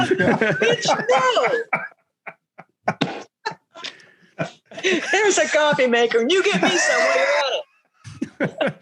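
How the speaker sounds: high, laughing comeback; mock bossy refusal. Colonel turning down a coffee request, laughing mock indignation. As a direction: boss them about in mock outrage, breaking into laughter.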